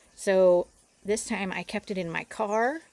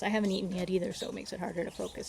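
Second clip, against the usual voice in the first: ordinary talk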